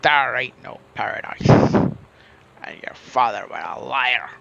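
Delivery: hammy pirate, slowing on the insult; awful pirate voice. Pretend. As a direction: taunt like a bad stage pirate. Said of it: "awful pirate voice".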